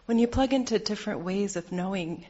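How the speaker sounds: usual speaking voice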